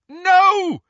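loud, high-pitched cry; shout. A narrator's reading. Harry to the figure gliding at him, loud shout. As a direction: shout it out in alarm. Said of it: "Harry shouted".

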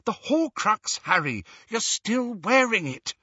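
high-pitched, insistent alarm; urgent exclamation. A narrator's reading. Hermione realizing Harry wears the Horcrux, urgent. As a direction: press the point urgently.

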